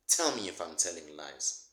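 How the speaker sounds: normal talking voice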